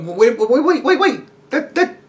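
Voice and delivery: mock-frantic high-pitched pleading; panicked union. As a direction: stammer it out in high alarm. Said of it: "And they went".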